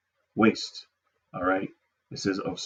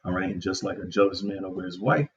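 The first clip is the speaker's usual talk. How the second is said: ordinary talk, nothing marked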